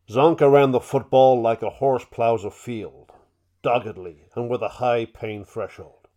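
hands over mouth, booming, slow NFL Films narrator; muffled through hands, slow and loud; John Facenda impression. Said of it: "put my hands on my mouth and do my impression of, uh, of, of John Facenda".

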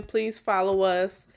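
ordinary talk

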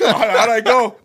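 laughing through the words. Real, not acted.

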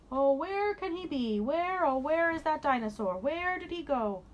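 high, slow, exaggerated wondering; pretend searching. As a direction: ham up looking everywhere. Make believe we can't find him.

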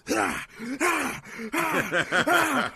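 high rhythmic mock grunts with each blow; imitated grunting. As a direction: grunt along like working the ribs. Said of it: "he was like".

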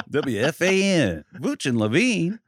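slow, drawn-out mock station ident; hammed-up radio sign-off, sliding up high; drive-time radio jingle. Joking with co-host, mock radio station jingle. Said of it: "sounds like a drive time radio show".

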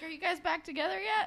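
high, loud imitated question; mock naive hopefulness. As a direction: imitate a sweet boy hoping they made up. Retold naive Connor.

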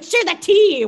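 high, loud, insistent call; loud mock demand. Imitating listener demanding gossip, loud and insistent. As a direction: belt it out as a mock order.

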